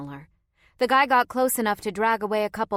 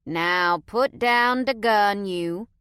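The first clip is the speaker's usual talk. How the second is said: slow, louder Cajun coaxing; Cajun accent; 5 dB louder, much slower than usual. A narrator's reading. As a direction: gently coax in Cajun. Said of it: "in a heavy Cajun accent".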